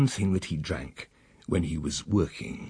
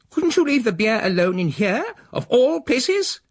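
much higher, louder disgusted prim voice; outraged lady; 4 dB louder than usual. Acted. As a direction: spit the word out like a scandalised spinster. The female scolds him.

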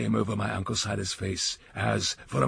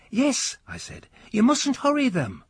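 put-on a high, eager boy begging his uncle; the nephew keeping the old man awake; young boy nudging sleepy uncle, high and pleading; young boy's voice; 13 semitones higher than usual.

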